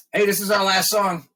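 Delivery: high, loud stage announcement; singer's announcement. Retold or imitated. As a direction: call it out to the crowd. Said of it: "my singer goes".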